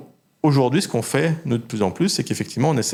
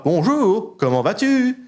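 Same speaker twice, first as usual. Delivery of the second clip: voice jumps; 10 semitones higher, slower than usual. Shown as a demonstration. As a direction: mimic a speech generator with sudden jumps in pitch. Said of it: "faire des sauts de voix".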